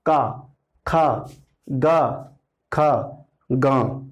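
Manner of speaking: slow drawn-out syllables ka kha ga; "का, खा" said slowly and deliberately as a sound demo; sounding out consonants. Shown as a demonstration. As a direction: articulate each syllable from the throat. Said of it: "का उच्चारण कर लीजिए".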